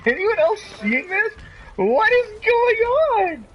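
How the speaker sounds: high, slow, loud disbelief; stunned. Real, not acted. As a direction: cry out in real astonishment. Stunned by changing colors.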